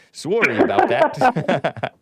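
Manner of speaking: slow, laughing imitation of a Canadian accent; mock Canadian accent. Host playfully mimicking a caller's apology in a Canadian accent. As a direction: tease with a drawn-out Canadian "sorry". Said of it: "in your Canadian accent".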